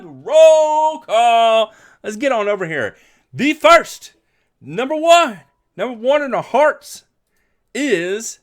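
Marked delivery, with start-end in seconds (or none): 0.0-1.7 loud, drawn-out, high announcement; big announcer call. Pretend. Host opening the roll call for the livestream, booming announcer call. 3.3-8.4 high, loud show-host announcement; announcer call-out. Pretend. Host naming who was first in, big announcer voice.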